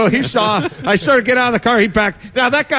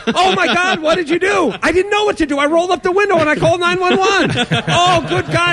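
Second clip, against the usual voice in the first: mock gasping guys; 9 semitones higher than usual. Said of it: "it's like".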